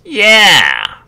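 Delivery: loud, high, gleeful yeah; excited yeah. Acted. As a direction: burst out with it like a customer delighted to agree. Customer's eager reply to clerk.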